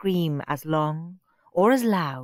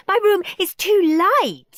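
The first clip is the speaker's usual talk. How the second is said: whiny Margaret; 13 semitones higher, 7 dB louder than usual. Acted. Margaret keeps complaining.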